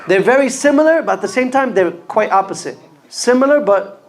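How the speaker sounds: ordinary delivery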